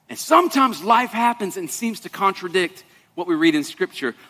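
ordinary talk

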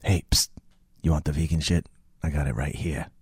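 low, hushed sales pitch; furtive dealer. Acted. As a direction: offer it secretly, like contraband. Improv scene, furtive dealer offering goods.

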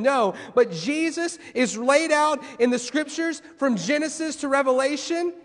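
preaching at full force. Real, not acted. Pastor preaching to church about faith, impassioned full force. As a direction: drive the point home to the church.

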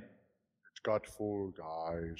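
slowed, dropping low like a dying mic; glitchy fading audio. Pretend. Mocking a friend's broken stream audio, slowed and fading. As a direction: mimic a stream cutting out mid-word. Mimics John's cut-out audio.

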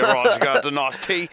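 lightly put-on British accent over tea; mock British accent. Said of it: "That was, uh, British".

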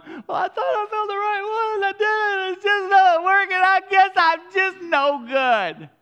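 high, loud, tearful wail; crying girl. Retold or imitated. A young girl on the radio crying over love, high tearful wail. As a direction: sob it out like a heartbroken girl.

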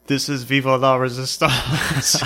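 slow mock-French call with laughter in it; laughing mock French. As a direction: laugh through the silly call sign. Laughing while repeating the joke.

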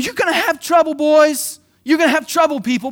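Jesus telling the disciples straight; a lightly voiced urgent Jesus; earnest warning.

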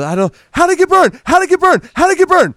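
high frantic yelling, repeating the question; mock panic, demanding an answer; freaking out. Man freaking out at his wife over a burned doll. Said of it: "flips the fuck out".

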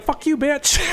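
high-pitched jokey retort breaking into laughter; mock insulted retort. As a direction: squeal it as a joke, then crack up.

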